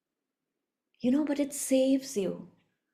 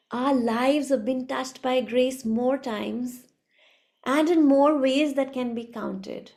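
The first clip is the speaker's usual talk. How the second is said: ordinary delivery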